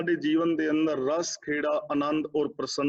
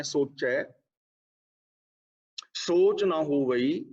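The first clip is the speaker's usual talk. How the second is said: a clear pause after ਸੋਚੈ, then ਸੋਚ ਨਾ ਹੋਵਈ said slowly; pause after the first word; slower than usual. Shown as a demonstration. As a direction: stop after ਸੋਚੈ before reading on. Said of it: "ਸੋਚੇ ਤੇ ਵਿਸ਼ਰਾਮ ਲਗਾਉਣਾ ਹੈ".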